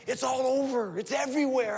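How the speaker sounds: high and loud, bursting with the news; excited wife. Retold or imitated. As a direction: tell him it's everywhere, all worked up. Wife excitedly telling him news.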